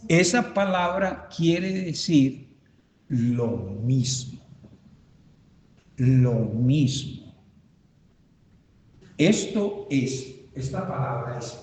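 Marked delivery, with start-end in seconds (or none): 3.1-7.3 drawn-out words, pitched lower; slow, deliberate. Real, not acted. Teacher giving the class a word's meaning, slow and deliberate. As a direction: spell out the meaning slowly.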